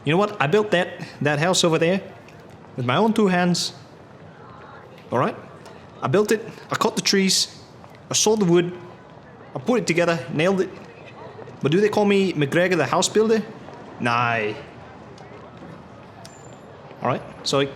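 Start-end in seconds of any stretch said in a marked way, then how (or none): 0.0-0.4 higher, hammed-up Scottish accent as McGregor. McGregor boasting to his drinking buddies in a Scottish accent. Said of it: "Oh, I forgot my accent". 0.4-14.5 higher, hammed-up Scottish accent for a boastful old builder. McGregor bragging to his buddies at the bar, in a Scottish accent. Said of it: "Oh, I forgot my accent".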